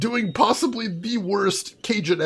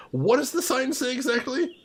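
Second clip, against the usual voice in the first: mock polite ask; 6 semitones higher, 5 dB quieter than usual. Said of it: "Oh, I'm sorry... might not have heard".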